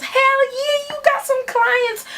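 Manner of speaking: very high, gleeful squeal; mock-excited high voice; imitating eager friend.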